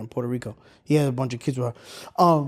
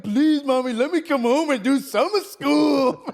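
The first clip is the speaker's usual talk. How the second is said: high pleading whine, half laughing; mock begging; 9 semitones higher than usual. Pleading with mom to come home for summer school, high and whiny. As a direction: beg mommy like a kid, laughing through it.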